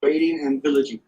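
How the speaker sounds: loud, proud card read; loud announcement. Pretend. Host announces the winning card to the table, loud. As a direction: boom it out as the winner.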